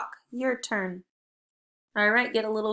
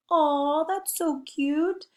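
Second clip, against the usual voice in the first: higher, slow, drawn-out cooing; 6 semitones higher, much slower than usual. Acted. Teacher showing the aw sound, cooing in adoration. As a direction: gush over something cute, voice lifted and stretched. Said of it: "Kind of like you're like".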